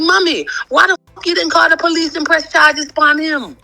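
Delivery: incredulous friends. Retold or imitated. Friends' incredulous question retold.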